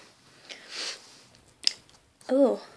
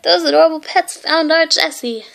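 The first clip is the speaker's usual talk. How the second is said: a touch higher, gushing mom; delighted mother praising the pets; mother gushing over the pets; lightly voiced mother; 4 semitones higher, 4 dB louder than usual. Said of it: "cried Jessie's mother".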